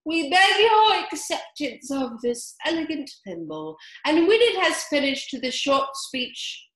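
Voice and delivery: lightly pompous presentation speech; solemn dodo speech. A narrator's reading. Dodo presenting the thimble to Alice, hammed-up solemn. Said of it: "solemnly presented the thimble".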